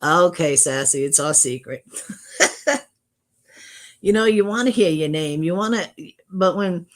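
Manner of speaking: ordinary delivery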